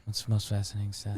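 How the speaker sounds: low, fast, put-on voice; mock echo. Cohost mockingly echoing his partner's question. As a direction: parrot the question in a silly low voice.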